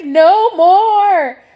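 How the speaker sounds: panicked loud outcry; hysterical queen. Acted. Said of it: "she is hysterical at this point".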